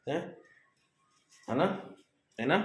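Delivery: usual speaking voice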